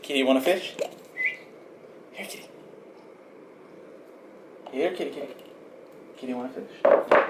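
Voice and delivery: high coaxing call to a cat; calling a lost pet. Real, not acted. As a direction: coax the kitty over sweetly.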